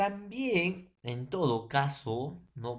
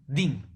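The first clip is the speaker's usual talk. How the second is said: "Din" punched out louder, on its own; stressed syllable; 2 semitones higher, 5 dB louder than usual. Shown as a demonstration. Teacher showing the tonic syllable, firmly stressed. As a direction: hit "Din" with more force. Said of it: "que se pronuncia con mayor intensidad".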